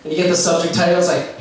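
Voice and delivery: ordinary delivery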